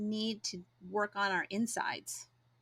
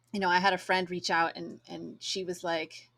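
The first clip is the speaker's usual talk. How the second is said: usual speaking voice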